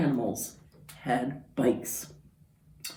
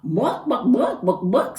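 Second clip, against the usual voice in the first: higher mock clucks; chicken clucking; 7 semitones higher, 5 dB louder than usual. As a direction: cluck like a hen. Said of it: "said Chicken".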